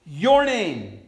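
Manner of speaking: very slow, high and loud; emphatic proclamation. Acted. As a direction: draw each word out as a bold plea. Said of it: "Jesus says".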